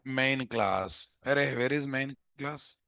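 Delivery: normal talking voice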